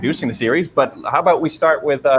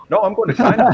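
insistent high Newfie twang; half-mocking Newfoundland insistence; Newfoundland accent; 9 semitones higher than usual. Retold Newfie insisting on China.

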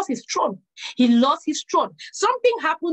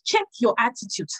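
normal talking voice

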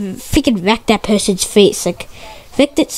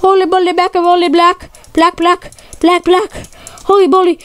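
high, frantic repeating; panicked stammering; 6 semitones higher than usual. Real, not acted. Gamer stammering in panic mid-fight, frantic repeats. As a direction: babble in gaming panic. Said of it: "I'm, like, stuttering right now".

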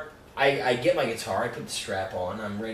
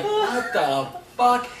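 mock baffled; 8 semitones higher, much slower than usual. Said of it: "And Harry's like".

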